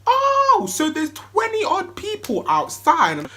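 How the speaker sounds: high, slow, stunned gasp of disbelief; shocked. Retold or imitated. Party host finds a crowd outside, high shocked disbelief. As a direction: act out seeing the crowd at the door.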